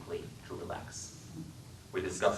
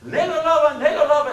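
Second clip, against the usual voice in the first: much higher, much louder screamed Southern taunt; shrilled taunt; 10 dB louder than usual. Acted. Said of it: "as he shrilled".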